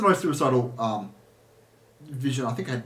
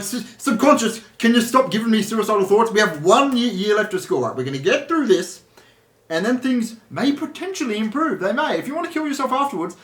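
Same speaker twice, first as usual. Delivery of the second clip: higher, louder pleading with yourself; re-enacted exasperated plea, pitched up; exasperated pep talk; 7 semitones higher, 6 dB louder than usual. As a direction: reason urgently with your own subconscious. Pleading with own subconscious, re-enacted.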